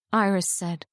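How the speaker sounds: usual speaking voice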